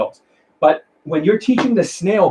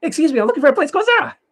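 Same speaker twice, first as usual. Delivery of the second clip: much higher and fast, cheerful rush; fast and happy; 13 semitones higher, much faster than usual. Shown as a demonstration. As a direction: zip through it brightly, upbeat. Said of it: "they go fast and they're happy".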